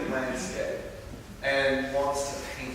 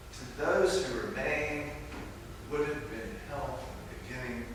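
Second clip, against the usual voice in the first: softer repeat in a lighter Scottish lilt; hushed Scottish echo; 7 dB quieter than usual. Acted. Preacher repeating the Spirit's line to let it sink in, quiet Scottish accent. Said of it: "do a really bad Scottish accent".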